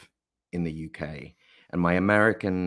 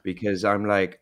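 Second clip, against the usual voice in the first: ordinary talk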